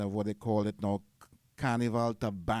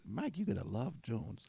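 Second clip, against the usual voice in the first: softer, imitated American accent; 9 dB quieter than usual. Friend repeating the phrase to Mike, in an American accent. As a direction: mimic an American speaker quietly, in their accent. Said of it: "American accent".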